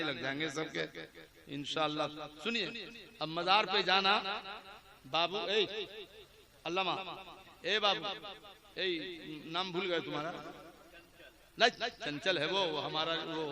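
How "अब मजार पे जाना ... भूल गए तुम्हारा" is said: ordinary talk, nothing marked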